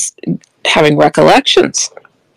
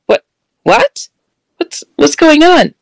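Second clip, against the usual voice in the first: high, bewildered questioning, reenacted; retold shock, pitched way up; startled confusion; 11 semitones higher than usual. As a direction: stammer it in alarmed surprise. Retold shocked reaction to sister's scream.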